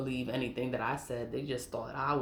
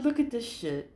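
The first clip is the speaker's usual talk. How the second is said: higher, showing her outrage; indignant; 7 semitones higher than usual. Retold or imitated. Showing her daughter the liar's email, higher and indignant.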